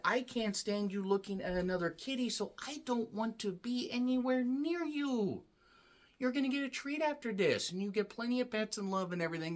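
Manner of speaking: high, soft voice for the cat; cat's voice. Acted. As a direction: speak as the sulky cat. Voicing the cat's thoughts.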